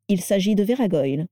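plain reading voice

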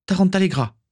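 high, ringing spell call; spell incantation. Acted. Morgan casting a spell at an enemy, high incantation. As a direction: cast it out high and sharp.

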